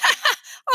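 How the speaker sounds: high-pitched giggly laugh; short high cackle of amusement; child's laugh. A narrator's reading. Fudge laughs at writing for Uncle Feather, amused laugh. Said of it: "Fudge laughed".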